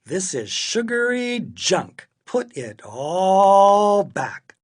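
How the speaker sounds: scold, leaning long on "all"; fed-up father's command; exasperated scolding. A narrator's reading. Father scolds daughter over junk.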